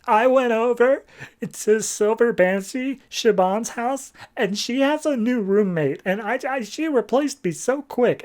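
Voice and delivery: high-pitched tearful wail, hammed up; mock crying. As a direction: act out a weepy breakdown. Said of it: "starts breaking down crying".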